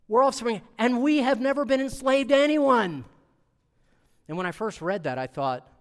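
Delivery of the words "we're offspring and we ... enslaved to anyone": loud, high, chest-out bragging; puffed-up boast. Retold or imitated. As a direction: brag it proudly, chest puffed out. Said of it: "puff their chest out and say".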